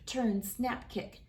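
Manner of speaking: usual speaking voice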